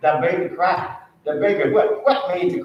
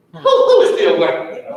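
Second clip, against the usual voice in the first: pitched way up with a laugh, rousing the room; excited rising call; 12 semitones higher, 4 dB louder than usual. Real, not acted. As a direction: fire them up with the question. Preacher rousing the class.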